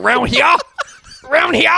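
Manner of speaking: high, loud Cajun twang on round here; Cajun accent. Retold or imitated. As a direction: mimic a Cajun kid yelling round here. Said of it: "He's like".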